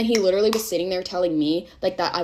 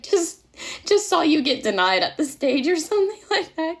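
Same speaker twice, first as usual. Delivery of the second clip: high, laughing delivery; giggling as she reads it out; 10 semitones higher, 3 dB louder than usual. Retold or imitated. Texting him, laughing.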